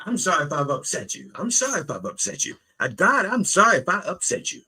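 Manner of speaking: fast, high-pitched begging; hurried, imploring repetition; pleading apology. Acted. Acting the man bargaining with God.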